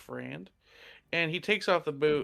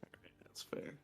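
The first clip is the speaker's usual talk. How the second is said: whispered aside; muttering to self; 3 semitones lower, 20 dB quieter, faster than usual. Real, not acted. Player whispering to himself while rolling dice.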